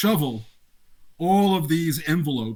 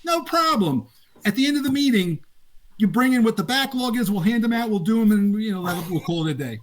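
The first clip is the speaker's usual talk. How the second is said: voicing the boss; 7 semitones higher than usual. Boss telling the new rules editor no problem, raised easygoing voice. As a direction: play the relaxed boss brushing it off. Boss reassures in retold story.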